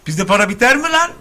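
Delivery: high boastful show-off voice; mock rich guy. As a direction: brag like a flashy rich guy.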